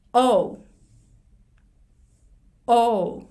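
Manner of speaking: "O" started with the mouth open vertically, then glides to u; demo vowel O. Shown as a demonstration. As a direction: say "O" as a rounded o sliding into u. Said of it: "Oっていう母音から練習してみましょう". (「O」を口を縦にして始め、うへ動かす)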